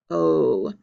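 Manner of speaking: letter name dropped low. Shown as a demonstration. Teacher reciting a vowel for pupils, low voice.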